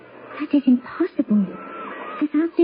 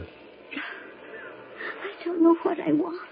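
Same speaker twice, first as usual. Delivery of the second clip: very slow, drawn-out, much softer; lost, hushed confusion; 4 semitones higher, 9 dB quieter, much slower than usual. Acted. The daughter murmurs her doubt to her lover, slow and soft. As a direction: trail off as if lost in thought.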